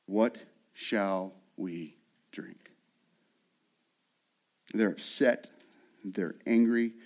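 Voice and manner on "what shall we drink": slow, low, soft grumbling question; slow, hushed grumble, lower and softer; hushed complaint. Acted. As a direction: let it drag out low and quiet. Quoting grumbling Israelites.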